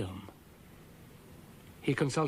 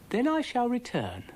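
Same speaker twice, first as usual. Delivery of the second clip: Brian's voice; 11 semitones higher, 4 dB louder than usual. A narrator's reading. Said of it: "said Brian".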